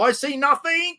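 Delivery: high, hammed-up German guard; Schultz impression. Guard denying the tunnel, high mock German voice. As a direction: play the bumbling guard who sees nothing. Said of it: "he'd stumble across the tunnel and go".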